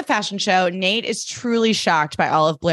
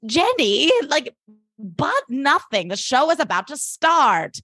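higher, dismissive brush-off; dismissive Eleanor; 7 semitones higher, slower than usual. Retold or imitated. Eleanor brushing Jenny off before the show, high and dismissive. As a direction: wave her off, slow on "but nothing".

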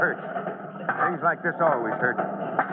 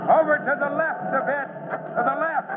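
high, carrying call to the operator; calling out directions; 9 semitones higher than usual. Acted. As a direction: shout it across the rubble. Chief directing the steam shovel.

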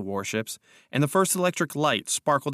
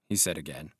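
ordinary delivery, nothing marked

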